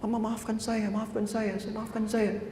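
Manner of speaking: mild crying plea. Acted. Child crying to his mother, mildly tearful and soft. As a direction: softly beg forgiveness as a crying child. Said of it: "bangun menangis".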